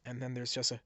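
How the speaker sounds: usual speaking voice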